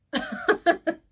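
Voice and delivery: high, loud burst of laughter. Real, not acted. Reacting to the boys' trip, high incredulous laugh.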